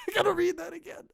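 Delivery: high, quick words through laughter; laughing. Real, not acted. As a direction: giggle it out in a rush. Laughing before re-reading.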